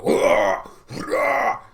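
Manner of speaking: hammed-up hurrah shouted twice; mock battle cry. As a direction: roar it like charging tigers. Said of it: "They're gonna go".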